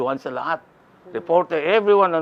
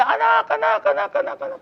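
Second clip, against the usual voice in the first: much higher, excited calls of anak; neighbours calling out. Retold or imitated. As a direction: call out to him like jubilant neighbours. (masiglang sigaw ng mga kapitbahay, mataas)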